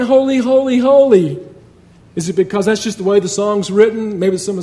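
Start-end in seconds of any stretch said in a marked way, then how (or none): 0.0-1.4 raised, emphatic echo of the cry; echoed shout. Retold or imitated. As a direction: call the repeated word out higher and louder.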